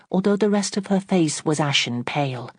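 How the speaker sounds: ordinary delivery, nothing marked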